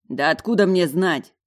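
loud, annoyed snap back; irritated retort. A narrator's reading. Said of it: "огрызнулся он раздражённо". (раздражённо огрызнуться)

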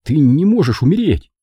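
refusing to accept the loss; pleading. Acted. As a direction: plead with a dying uncle, voice lifted. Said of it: "с мольбой".

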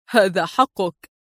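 chuckle, then slow amused concession; laughing reply. A narrator's reading. Adib teasing her with her own words, laughing. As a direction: laugh and grant it slowly. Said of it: "ضحك قائلا".

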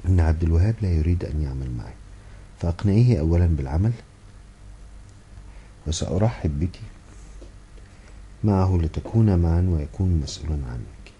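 ordinary delivery

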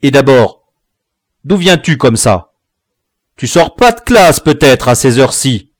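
loud, accusing father; angry questioning. A narrator's reading. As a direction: interrogate a late child sharply. Father interrogates late son.

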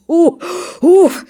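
loud high puffs from the cold; громкое пыхтение; puffing blows. Acted. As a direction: blow out hard through the lips. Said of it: "слышится".

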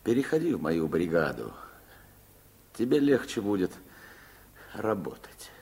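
ordinary delivery

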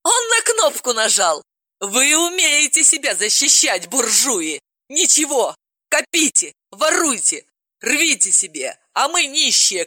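high, hysterical tirade; furious rant. Acted. Polina rages at Sobolev and the guards, hysterical. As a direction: spit it at the rich in rage.

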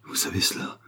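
frightened whisper, voice gone; terrified. A narrator's reading. Valet accused of two crimes, terrified whisper. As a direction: whisper it in sudden terror. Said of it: "manifesta soudain une terreur très vive".

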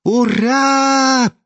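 high drawn-out tipsy hurrah; squeaky drunk's hooray; drunken hoarse cheer. A narrator's reading. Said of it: "пьяным голоском прохрипел Лебедев".

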